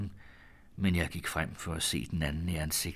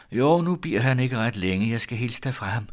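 quick answer; 4 semitones higher, 7 dB louder than usual. A narrator's reading. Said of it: "svarede lige så hurtigt".

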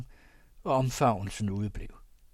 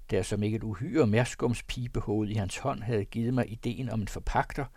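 plain reading voice

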